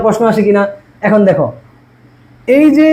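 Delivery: ordinary talk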